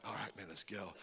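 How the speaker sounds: low, soft retold cue to a buddy; hushed go signal. Buddy to buddy, sneaking out at night, hushed. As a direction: quietly get your friend moving.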